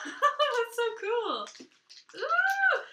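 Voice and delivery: high, slow, loud gasp of delight. Real, not acted. Knitter opens the peel-open wrapper, squealing with delight. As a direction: marvel at the clever wrapper.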